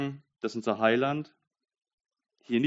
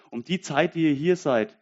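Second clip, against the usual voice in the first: higher and louder, fervent sermon push; preaching at full force; 5 semitones higher, 5 dB louder than usual. Acted. Preacher to congregation, quoting Jesus, fervent preaching. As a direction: proclaim it to the back row.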